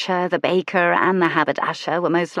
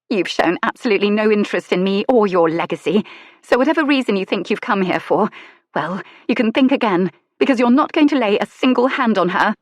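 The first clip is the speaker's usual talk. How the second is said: higher, louder protective mother, building; fierce as a wolf guarding her pup; fierce lady; 3 semitones higher, 4 dB louder than usual. Acted. Lady defends her daughter.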